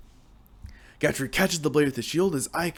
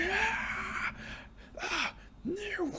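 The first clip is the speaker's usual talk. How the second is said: shrill screaming, much higher yet softer; high pained screams; 10 dB quieter, much slower than usual. Acted. Wounded bandit gripping his stump, high pained screams. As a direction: cry out over a lost arm.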